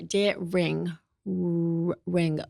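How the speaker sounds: "ring" led by a held, drawn-out R; R demonstration. Shown as a demonstration. Teacher shows learners the R in daring, drawn-out R. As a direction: hold the R, then release into "ring". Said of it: "And then for the R".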